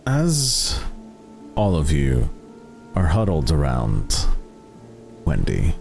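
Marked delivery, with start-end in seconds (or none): none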